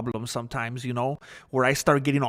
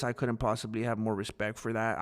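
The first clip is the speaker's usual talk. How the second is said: ordinary talk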